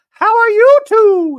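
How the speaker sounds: very high, loud, slow squeak; Dorit impression. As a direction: chirp it brightly like a socialite. Dorit voiced.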